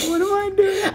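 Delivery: high laughing voice. Real, not acted. Laughing at himself.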